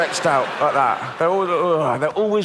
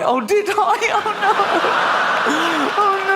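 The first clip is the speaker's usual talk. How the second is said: high squeaky dismayed little voice; horrified child; much slower than usual. Retold or imitated. Niece realizing she crushed the puppy, high and horrified. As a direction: gasp like a kid caught out.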